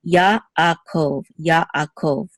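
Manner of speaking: Yaakov split as Ya, akov; separated sounds. Shown as a demonstration. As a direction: pause between the two a sounds of Yaakov. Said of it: "we're gonna separate the sounds".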